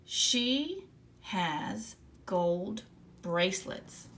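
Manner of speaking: slow, word by word read-aloud. Shown as a demonstration. Teacher modelling the sentence slowly for young readers. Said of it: "let's read my sentence".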